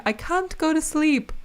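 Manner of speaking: mock Australian whine; Australian kid accent. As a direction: imitate a child's Australian accent from Bluey. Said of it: "He started saying".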